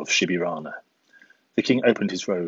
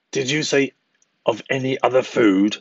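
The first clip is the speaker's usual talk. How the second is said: higher, scornful echo of the king; mocking; 5 semitones higher, 3 dB louder than usual. A narrator's reading. Eagle mocking the king's offer, scornful and high. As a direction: throw his words back in mockery. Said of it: "said the eagle mockingly".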